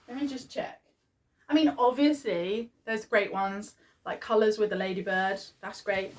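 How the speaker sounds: normal talking voice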